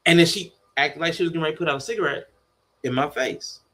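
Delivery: usual speaking voice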